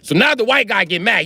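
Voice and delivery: ordinary talk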